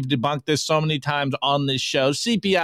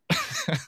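high burst of laughter; 6 dB quieter than usual. Real, not acted. Laughs at his dirty fork.

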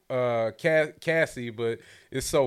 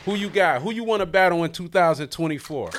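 louder, higher hype-man push to call out rivals; hyped challenge; 6 semitones higher, 7 dB louder than usual. Real, not acted. Host hyping the guest to name her battle rap opponents, excited and loud.

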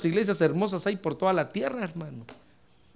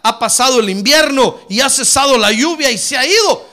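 loud scripture reading, driven to the end; proclaimed verse; 3 semitones higher, 9 dB louder than usual. Real, not acted. Preacher reading Cantares to the congregation, loud proclamation. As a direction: declare it forcefully to the whole church. Reads verse aloud with force.

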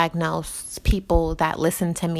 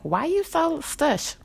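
mocking quote; 10 semitones higher, slower than usual. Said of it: "they'd be like".

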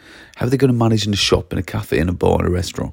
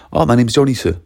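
polite pupil answer; 3 semitones higher, 4 dB louder than usual. Shown as a demonstration. Pupil gives forgotten name to teacher, politely. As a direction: offer the name willingly, with respect. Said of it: "It comes across as polite".

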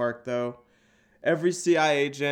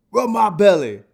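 mock deep dog voice; 8 semitones higher, 12 dB louder than usual. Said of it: "hearing a deep voice".

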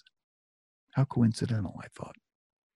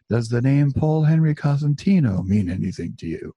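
measured question, pitched higher and louder; rehearsed line; 5 semitones higher, 5 dB louder than usual. Retold or imitated. Nervous son rehearsing for a phone call, practiced delivery. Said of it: "practicing my lines".

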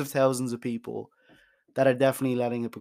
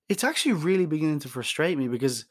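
ordinary delivery

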